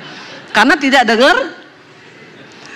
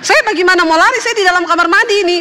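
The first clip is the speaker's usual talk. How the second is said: raised, quicker wife answering back; wife's indignant reply, pitched up; exasperated retort; 7 semitones higher than usual. Retold or imitated. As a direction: fire back at him from behind the bathroom door.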